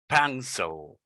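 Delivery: "pencil" falling, ending in a dark "sul"; correct pencil. Shown as a demonstration. Teacher modelling the word for learners, careful pronunciation. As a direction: reduce the second vowel of "pencil". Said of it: "It's not pencil, it's pencil".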